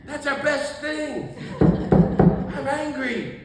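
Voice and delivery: slow, high, loud pretend protest; mock indignation. Preacher to congregation, mimicking activists defending their anger. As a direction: draw it out like an offended activist, hammed-up.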